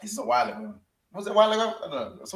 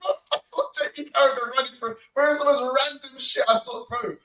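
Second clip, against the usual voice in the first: high, louder amazed telling; excited disbelief; 9 semitones higher, 4 dB louder than usual. Real, not acted. Telling his friend he saw Carragher running, excited disbelief. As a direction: insist on it, pitched high in real amazement.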